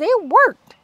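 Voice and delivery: high, delighted mouse squeak. Acted. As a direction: squeak it out in glee. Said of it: "squeaked Big Cheese".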